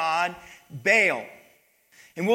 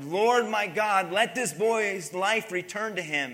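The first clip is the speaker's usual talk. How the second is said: usual speaking voice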